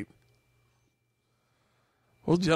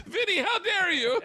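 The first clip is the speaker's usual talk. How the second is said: high mock outrage. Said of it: "Joey goes".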